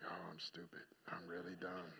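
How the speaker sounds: very low, barely voiced thought; hushed self-reproach. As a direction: murmur it to yourself, chastened. Acting out his shamed inner reaction.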